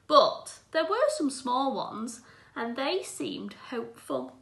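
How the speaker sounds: put-on gentle, clear read-aloud for children; teacher reading a picture book aloud; storybook reading. A narrator's reading. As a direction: read it like a bedtime story to the class.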